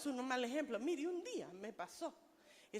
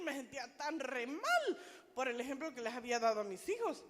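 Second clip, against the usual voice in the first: ordinary talk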